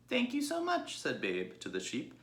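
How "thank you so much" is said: a sweet, well-mannered little pig; polite young pig. Acted. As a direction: Babe greeting the sheep, high and polite. Babe's voice.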